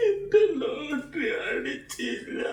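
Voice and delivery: crying. Acted. കരയരുത്.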